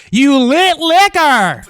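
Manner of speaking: slow, high, loud taunt; drawn-out mocking yell; jeering holler. Real, not acted. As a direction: shout it out long and high to mock him. Commentator jeers at the arrested man.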